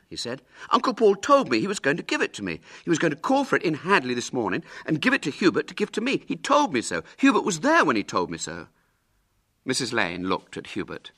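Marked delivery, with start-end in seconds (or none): 0.7-8.7 high, rapid, loud protest; indignant. Acted. William pleading his case to Mrs Lane, indignantly. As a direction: gabble it out in boyish indignation. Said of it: "He turned indignantly to Missus Lane".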